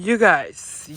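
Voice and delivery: normal talking voice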